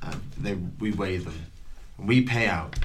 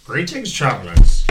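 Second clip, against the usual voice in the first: loud, high hail to customers; hearty shopkeeper greeting; 4 semitones higher, 11 dB louder than usual. Acted. Game master as shopkeeper to arriving travellers, loud greeting. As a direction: hail the arrivals in a raised character voice.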